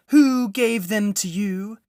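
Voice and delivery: high, loud, slow demand; menacing witch. A narrator's reading. Witch demanding who gave the food, slow loud menace. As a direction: drag out the threat like a cold queen. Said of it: "said the witch".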